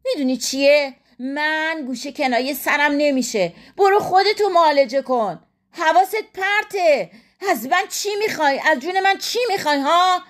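high-pitched, indignant retort; exasperated wife. A narrator's reading. Wife rejecting husband's insinuations, exasperated. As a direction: fire back with rising exasperation.

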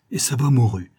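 ordinary delivery, nothing marked

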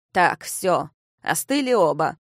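put-on firm old man calming things; an old lord settling the quarrel. A narrator's reading. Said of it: "Старый лорд нейтрализовал возрастающее напряжение".

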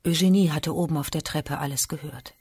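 ordinary delivery

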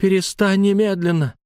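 high, urgent command to stop; moderately loud high shout; woman's cry. A narrator's reading. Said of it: "крик Лайлы".

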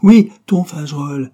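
loud, high and sharp outburst; angry retort. Acted. Irma snapping back at Jory, loud and angry. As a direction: fling it right back at him.

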